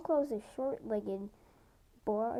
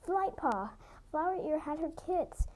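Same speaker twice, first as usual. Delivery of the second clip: happy yell; 7 semitones higher than usual. A narrator's reading. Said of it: "she yelled happily".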